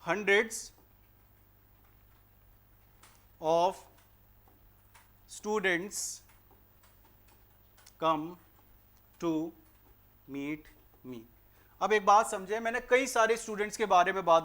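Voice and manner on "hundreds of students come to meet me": very slow, word by word example. Shown as a demonstration. Teacher to students, showing the example sentence slowly. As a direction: lay out each word of the example. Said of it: "तो उसको इस तरीके से बोला जाएगा".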